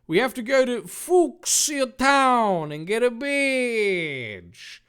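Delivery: slow, high, loud hero voice; exclaiming. A narrator's reading. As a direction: call it out eagerly, drawn out. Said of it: "Dave exclaimed".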